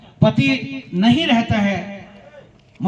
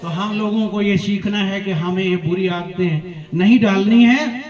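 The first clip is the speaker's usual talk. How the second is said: usual speaking voice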